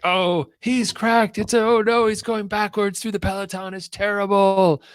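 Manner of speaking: high, loud mock-horrified commentary; hammed-up shrieking play-by-play; cycling commentator. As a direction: wail it like an overwrought race commentator. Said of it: "if you don't watch the commentary".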